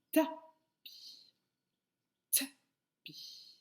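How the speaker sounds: "tapis" as a popped "ta", pause, then "pi"; syllables split apart. Shown as a demonstration. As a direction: break "tapis" in two with a gap. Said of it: "C'est le son".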